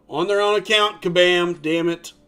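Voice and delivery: loud, exasperated yell at the game; frustrated outburst. Real, not acted. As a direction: blurt it out in loud annoyance.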